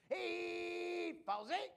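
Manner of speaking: high, slow hammed-up Cockney Londoner; mock Cockney accent. Magician mocking his own trick, slow high fake Cockney accent. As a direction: stretch it out in a silly Cockney accent. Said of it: "performing this with a British accent".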